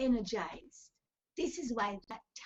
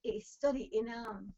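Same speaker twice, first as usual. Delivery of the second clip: usual speaking voice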